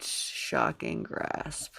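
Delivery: very low, slow whispered spell; dramatic spell name. Acted. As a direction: intone the spell ominously.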